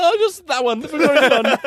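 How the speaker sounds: very high, flustered retelling; comic panic. Retold or imitated. Customer panicked at the situation, hammed-up high fluster. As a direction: squeak out a hasty choice in mock fluster. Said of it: "I was just so panicked".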